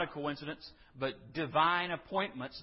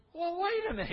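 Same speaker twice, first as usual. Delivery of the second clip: much higher, startled wait a minute; a jolt of surprise breaking in; sudden surprise. Real, not acted. Preacher reacts to grim interpretation.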